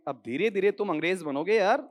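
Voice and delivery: ordinary talk, nothing marked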